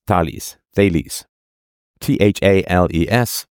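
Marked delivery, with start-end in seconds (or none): 0.1-0.6 "Thales" said as TAH-leez; alternate pronunciation. Shown as a demonstration. Trying out a name's pronunciations for listeners, Thales as TAH-leez. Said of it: "heard it pronounced a bunch of different ways". 0.8-1.2 "Thales" said as THAY-leez; alternate pronunciation. Shown as a demonstration. Trying out a name's pronunciations for listeners, Thales as THAY-leez. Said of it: "heard it pronounced a bunch of different ways".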